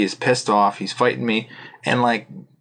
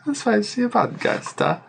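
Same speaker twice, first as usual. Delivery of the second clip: mock teasing; 10 semitones higher, slower than usual. Teasing echo.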